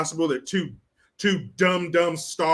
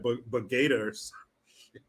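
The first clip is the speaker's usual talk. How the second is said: mock mumble; 4 semitones lower, 6 dB quieter than usual. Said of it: "she said, like".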